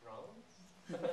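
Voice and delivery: very soft, hushed questioning echo; tiny stunned voice, played for laughs; imitating awed disciple. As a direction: barely voice it, as if in disbelief. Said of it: "You can see Peter going".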